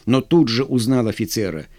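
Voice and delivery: ordinary delivery, nothing marked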